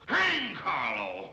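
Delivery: drawn-out, high angry shout; explode, stretching both words; exasperated shout. Acted. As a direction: bellow it slowly in sheer exasperation. Fed-up outburst, told to stop bellowing.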